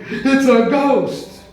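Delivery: high, slow mock-frightened cry; Peter scared. Peter spotting a figure on the waves, slow mock fear. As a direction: lightly act Peter's fright, drawn out. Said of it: "Peter's going".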